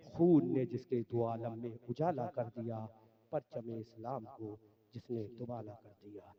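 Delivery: low, rhythmic devotional couplets; closing verse recitation. Acted. Preacher closing with couplets on Husain, rhythmic recitation.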